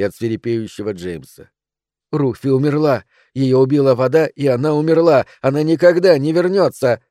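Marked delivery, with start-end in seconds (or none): 2.1-7.0 raised, higher voice close to rage; near fury. A narrator's reading. Mother telling her son Ruthie is dead, near fury. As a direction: blurt it out almost furiously. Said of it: "сказала Адель почти в ярости".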